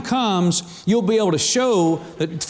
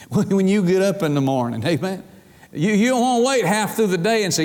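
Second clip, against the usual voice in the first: normal talking voice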